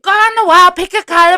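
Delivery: put-on loud Chinese-accented nail tech; mock Chinese accent. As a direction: order a client about in a put-on Chinese accent. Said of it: "she does a perfect Chinese person".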